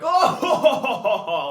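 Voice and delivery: high, loud theatrical fake laugh; Shakespeare style laugh. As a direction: ham up a stage-actor laugh. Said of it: "like this Shakespeare style, style laugh".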